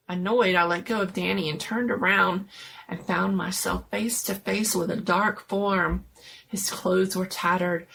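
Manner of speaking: usual speaking voice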